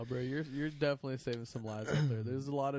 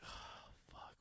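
worried whisper; 17 dB quieter than usual. Retold or imitated. Worried about bad knees.

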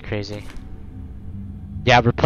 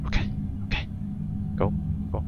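breathless whisper; tense whisper; 3 semitones lower, 12 dB quieter, much faster than usual. Real, not acted. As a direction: whisper it, scared of being heard. Tense hiding from robot.

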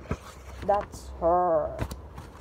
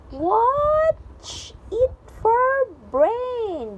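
loud, high, stretched-out shout; growled warning; 12 semitones higher, 6 dB louder, much slower than usual. A narrator's reading. Big furry bruiser scolding whoever bumped him, loud growled warning. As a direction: bellow it slowly, dragging out the words. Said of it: "the wall growled".

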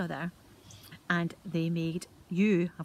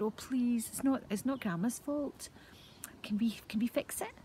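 higher, pleading plea; pleading boys; 6 semitones higher than usual. Acted. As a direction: beg the brownie to let them fix it. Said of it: "the boys were very disappointed".

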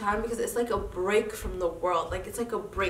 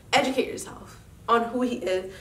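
ordinary delivery

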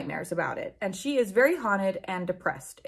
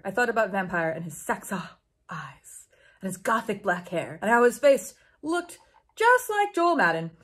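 ordinary talk, nothing marked

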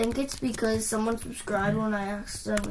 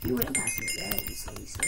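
whispered tease; 8 dB quieter, faster than usual. Acted. Whispered teasing.

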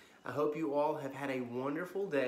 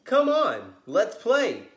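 high, loud call to play; 8 semitones higher, 15 dB louder, much slower than usual. Acted. Jagged-fin fish calls the others away, loud. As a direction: shout to the gang to come play. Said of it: "he called to the others".

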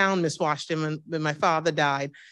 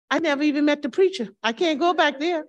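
exasperated complaint; 7 semitones higher, 3 dB louder, faster than usual. Retold or imitated. Complaining to the pastor on phone.

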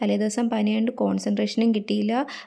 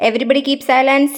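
shouting order; 4 semitones higher, 8 dB louder, much slower than usual. Acted. Class leader shouting order.

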